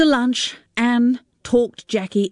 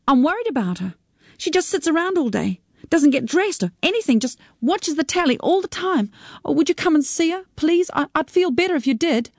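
higher, worried daughter begging a visit; a daughter urging, voice pitched up with worry; pleading daughter; 9 semitones higher than usual. Acted. Sarita pleads about her mother.

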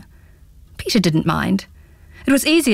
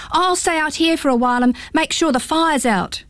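put-on a boy's higher easy voice; young boy offering to stay out; lightly voiced boy; 7 semitones higher than usual. Pins tells his mum he will stay out, light boy voice.

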